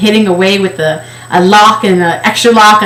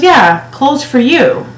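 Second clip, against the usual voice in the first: slow, higher, sugary mock clerk; mock saleslady; 6 semitones higher, slower than usual. Clerk showing the plus section, slow patronizing mock voice. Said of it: "She goes".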